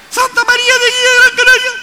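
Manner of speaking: very high, loud metallic call. Shown as a demonstration. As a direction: call it out across the mountains. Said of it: "El eco de una montaña".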